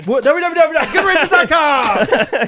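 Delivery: high, slow, laughing web address; hammed-up ad read. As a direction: belt out the web address like a cheesy ad.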